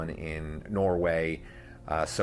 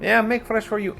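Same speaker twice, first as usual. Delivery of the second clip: higher-pitched market vendor's sales call; imitating the hummus hustler; 13 semitones higher, 4 dB louder than usual. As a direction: hawk it like a market stall vendor. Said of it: "the hummus hustler".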